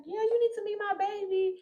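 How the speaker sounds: put-on a mom bragging about her baby; imitating the mom. The mom telling her to meet her daughter, lightly imitated and eager. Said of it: "she was like".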